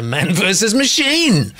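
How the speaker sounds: loud, high, slow build to the last word; hammed-up battle cry. Host reads out Jan's writers' strike comment, hammed-up battle cry. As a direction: announce it like an epic showdown.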